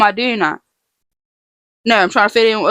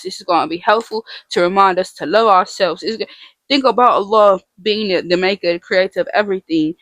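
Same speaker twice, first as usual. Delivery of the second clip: ordinary talk, nothing marked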